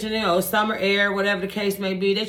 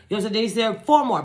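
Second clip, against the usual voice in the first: ordinary talk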